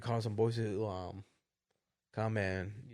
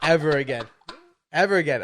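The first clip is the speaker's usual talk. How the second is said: louder and laughing, hammering ever both times; emphatic vow; 2 semitones higher, 8 dB louder than usual. Retold or imitated. Retelling his vow to his friend: loud emphatic repeat. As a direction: repeat it with growing conviction.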